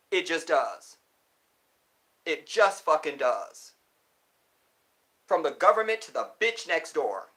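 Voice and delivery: real anger, hard-hit words; angry rant. Real, not acted. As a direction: lay down a loud, heated warning. Heated rant against oppressors.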